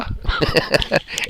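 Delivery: breathy laugh. Real, not acted. Laughs after quote.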